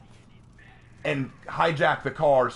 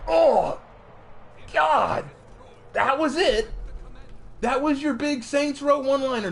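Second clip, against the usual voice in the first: incredulous disbelief; 12 semitones higher than usual. Retold or imitated. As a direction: sound floored by how lame the line is. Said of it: "And I'm like".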